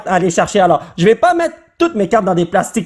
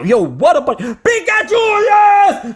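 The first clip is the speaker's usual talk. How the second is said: excited cheer; 12 semitones higher, slower than usual. Real, not acted. Finally pulls Pikachu.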